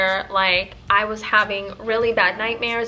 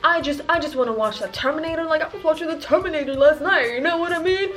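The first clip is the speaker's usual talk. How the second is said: mock neighbor voice; 4 semitones higher than usual. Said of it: "imagine Morgan Freeman narrating this with a much deeper voice".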